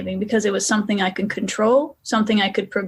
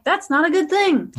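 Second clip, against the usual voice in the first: wry retort; 8 semitones higher than usual. Retold or imitated. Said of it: "I'm like".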